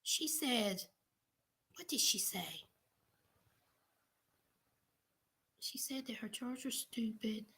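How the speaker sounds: half-whispered aside to herself; muttering to self. Real, not acted. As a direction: mumble while checking notes. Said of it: "Hold on".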